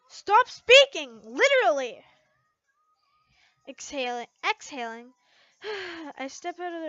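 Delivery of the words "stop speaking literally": loud outburst. Acted. Lasana snapping from stall.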